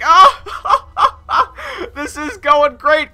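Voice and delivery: high, slow laugh-cry; overjoyed sobbing laugh; laughing through tears. Retold or imitated. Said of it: "I was like".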